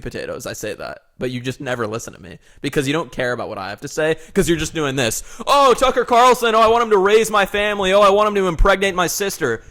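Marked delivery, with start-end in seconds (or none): 5.5-9.6 loud, high mock swooning; mocking fanboy gushing. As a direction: mimic an over-excited superfan. Mocking imitation of co-host's fandom.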